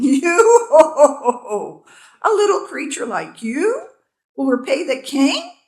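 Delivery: loud, high laughing scorn; laughing king. A narrator's reading. King laughing at the tiny bee, loud laughing. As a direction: laugh it out in disbelief. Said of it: "King Solomon burst out laughing".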